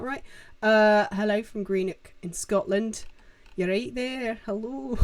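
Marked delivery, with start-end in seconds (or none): 3.6-5.0 put-on light, jokey Scottish accent; attempted Scottish accent. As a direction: have a playful go at a Scottish accent. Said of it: "There's my Scottish accent".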